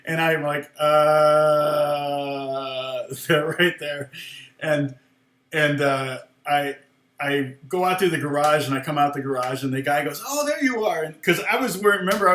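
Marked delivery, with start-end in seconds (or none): 0.8-3.2 long, level "uhhh" of dawning alarm; drawn-out "uh". Retold or imitated. Retelling stunned reaction on seeing them. 10.3-11.0 high-pitched mock call of recognition. Retells the guy calling out.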